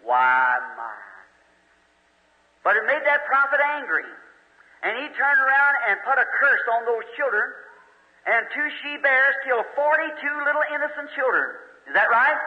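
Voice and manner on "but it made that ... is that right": preaching at full force. Acted. Preacher warning the congregation about unconfessed sin, at full force. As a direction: hammer the point home to the congregation. Preacher telling Elijah story.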